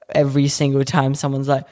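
usual speaking voice

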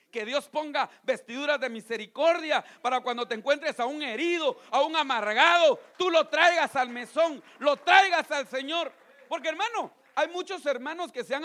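loud, slowing preacher's cry; fervent preaching. Acted. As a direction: preach it out with full force. Preacher rising to full force.

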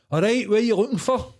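high, loud mock Glaswegian man; gruff big guy. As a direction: boom it out like a towering bearded local. Big bearded guy voiced.

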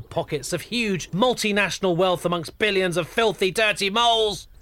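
high, loud, building tirade; outraged rant. Acted. Narrator raging at the moles' oil plot, outraged rant. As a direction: let the conspiracy rant climb to a loud peak.

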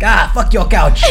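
loud, brash sketch impression. Co-host quoting a comedy sketch, brash mock defiance. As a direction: throw it out defiantly, half laughing. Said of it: "he's playing the guy [name]".

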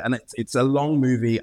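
ordinary talk, nothing marked

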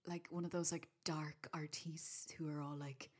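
soft unvoiced whisper. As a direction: breathe it in a whisper. Whispered mock description.

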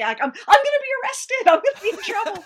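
mock panic, rushing and loud; frantic. Panicked about jury duty letter.

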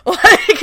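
sharp gasp of shock. Retold or imitated. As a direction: gasp as if horrified. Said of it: "The look on Sendel's face".

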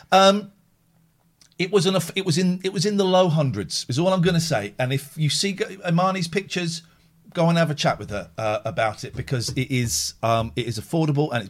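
ordinary talk

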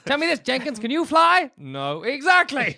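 high, blustering newspaper editor; blustering old boss. Pretend. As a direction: bark at Jenkins like a cranky boss. Mock boss grilling Jenkins.

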